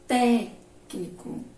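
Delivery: Técnico very slow, "Téc" punched, the rest dropping away; stress on first syllable. Shown as a demonstration. Teacher repeating the example for pupils, "Téc" strongly stressed in Técnico. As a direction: hit "Téc" hard, then let "nico" fall. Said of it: "a antepenúltima é a mais forte".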